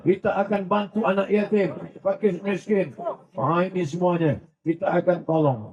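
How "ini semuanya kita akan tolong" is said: normal talking voice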